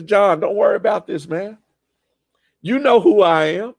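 a hint of Jesus, casual and reassuring; lightly voiced Jesus calming his cousin; voicing Jesus.

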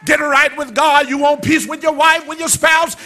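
rising, shouted preacher's call; preaching at full force. Acted. Call-and-response preaching builds.

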